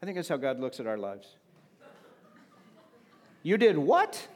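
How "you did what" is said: high, loud laughing "You did what?"; God in disbelief at the mess; mock surprise. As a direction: play it amused and astonished. God reacting to our mess.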